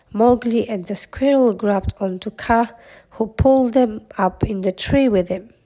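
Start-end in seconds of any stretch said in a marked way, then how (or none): none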